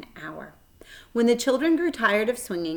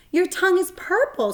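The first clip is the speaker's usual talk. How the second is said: much higher, louder squeal; screaming; 12 semitones higher, 5 dB louder than usual. A narrator's reading. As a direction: shriek it out playfully. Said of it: "screamed Fern".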